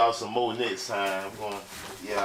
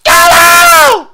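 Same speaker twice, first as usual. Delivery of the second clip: very high, much louder cry; shouted ad-lib; 22 dB louder than usual. Retold or imitated. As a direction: yell it out as the song's climax. Finishing the sung imitation.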